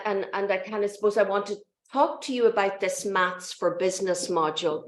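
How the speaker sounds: ordinary talk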